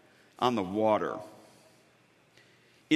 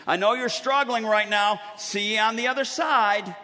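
breezy brush-off; 6 semitones higher, 3 dB louder than usual. Pretend. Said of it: "one of these things".

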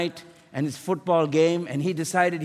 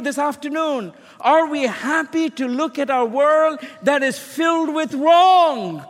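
higher, louder preacher's cry; fiery preaching; 6 semitones higher, 4 dB louder than usual. Acted. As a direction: press the congregation with rising fervor. Preaching at full force to congregation.

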